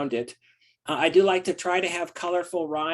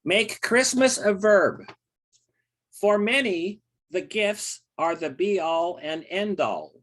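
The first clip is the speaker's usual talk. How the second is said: poem reading; 3 semitones higher, slower than usual. Acted. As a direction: read the poem out, measured and full. Poet begins his reading.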